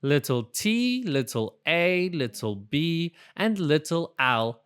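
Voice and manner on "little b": ordinary delivery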